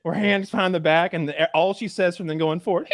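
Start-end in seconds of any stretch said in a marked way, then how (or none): none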